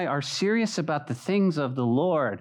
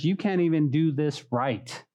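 ordinary talk

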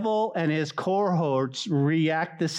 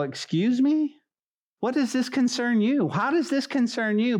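high-pitched affronted questioning; mock indignation; 6 semitones higher than usual. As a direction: act out indignant disbelief, pitch raised. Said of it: "They get angry".

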